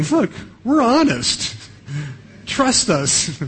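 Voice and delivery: high, slow, hammed-up "trust us"; mock earnest. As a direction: oversell the honesty with a wink to the audience. Brothers swearing honesty ironically.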